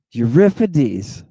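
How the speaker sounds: Euripides as "you-RIP-i-deez"; English pronunciation. Shown as a demonstration. Teacher contrasting with the Greek, Euripides as "you-RIP-i-deez". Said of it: "Not like we are".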